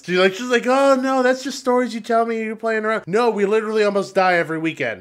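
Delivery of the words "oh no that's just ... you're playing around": higher-pitched mock mom voice; dismissive mom. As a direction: brush the kid off in a higher voice. Imagined mom dismissing kid's complaint.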